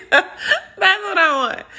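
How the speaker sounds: high giggling laugh into the words. Real, not acted. Laughing at her own wish for a booty rub. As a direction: laugh it off, slow and amused.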